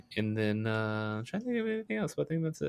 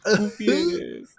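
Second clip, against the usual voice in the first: high drawn out; 10 semitones higher, 4 dB louder, slower than usual. Pretend.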